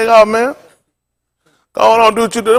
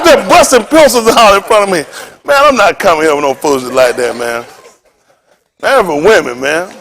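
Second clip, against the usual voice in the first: ordinary talk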